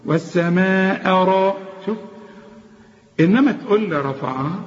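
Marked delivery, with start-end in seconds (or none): 0.1-1.6 والسماء را slow, heavy ra; light hamza, heavy ra. Shown as a demonstration. Teacher chanting a heavy ra in والسماء. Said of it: "هرقق أول همزة وأفخم أول الراء".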